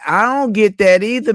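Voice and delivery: emphatic agreement. Real, not acted. Answering a viewer's complaint about not working, loud and emphatic. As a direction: hit "don't" hard, full agreement.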